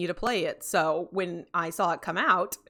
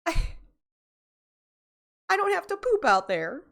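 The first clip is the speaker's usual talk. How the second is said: whiny outburst; 11 semitones higher than usual. Real, not acted. Whiny outburst about cold toilet.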